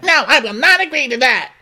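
very high mock outburst; hammed-up high-pitched defiance; indignant refusal. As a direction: refuse in a squealed huff. Acted refusal in retold argument.

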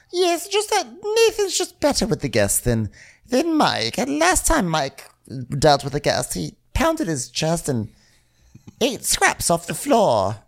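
very high, put-on wheedling boss; Faye impression voice. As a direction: ask in a squeaky-high imitation of Faye. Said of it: "Faye is like".

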